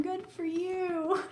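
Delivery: slow, high, patronizing praise; mock praise. Real, not acted. Teasing co-host for knowing the fandom term, slow mock praise. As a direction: pat them on the head with it, slowly.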